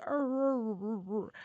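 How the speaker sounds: imitated dog "talking" noises; rolling arr-arr dog sounds, trailing down. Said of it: "he talks to me".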